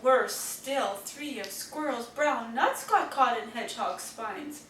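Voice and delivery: plain reading voice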